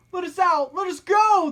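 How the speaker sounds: loud, high bellow. A narrator's reading. Captive demanding to be freed, bellowing high and loud. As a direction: roar to be let out. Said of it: "the anti-mountainist bellowed".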